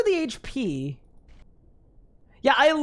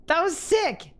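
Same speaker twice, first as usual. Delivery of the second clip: laughing; 7 semitones higher, 4 dB louder, slower than usual. Real, not acted. Still laughing after the kill.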